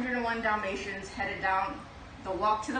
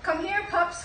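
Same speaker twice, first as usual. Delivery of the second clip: high calling voice, a bit louder; calling the pups; 8 semitones higher, 4 dB louder than usual. Acted. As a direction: coax the puppies over, sing-song call. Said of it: "called Purdy".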